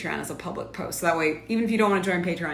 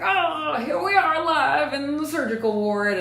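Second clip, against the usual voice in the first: higher, louder over-eager hospital vlogger voice; mock live-streamer; 9 semitones higher, 6 dB louder than usual. Mocking crazy people who stream from the surgical ward, in a higher, louder gushing voice. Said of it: "the crazy people that are like".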